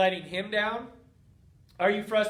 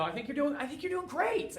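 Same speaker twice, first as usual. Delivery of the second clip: high, softer, rising mock-sweet praise; mock-sweet "I think you're doing great" with a high rising lilt; patronizing relative; 5 semitones higher, 6 dB quieter than usual. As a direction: coo it like a condescending aunt. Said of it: "people are like".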